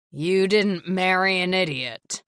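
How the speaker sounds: slow, loud near-snarl. A narrator's reading. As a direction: bite each word, barely holding back. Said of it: "nearly snarling".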